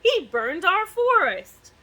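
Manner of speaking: high, loud, slow accusation; cried out. Acted. King accusing the dragon of burning the forest, slow and loud. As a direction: drag out the grievance loudly. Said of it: "he cried".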